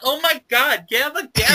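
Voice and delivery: mock bitchy girls. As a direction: yap like a bitchy college girl. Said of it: "these fucking bitchy girls come in. They're like".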